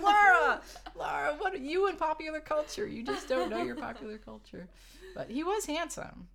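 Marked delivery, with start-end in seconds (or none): 0.0-4.7 high, loud mock exasperation; teasing coworkers. Coworkers teasing Laura about pop culture, high mock-exasperated. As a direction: scold her playfully for missing it. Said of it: "And they're like".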